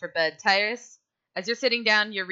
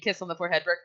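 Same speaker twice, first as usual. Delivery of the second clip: ordinary delivery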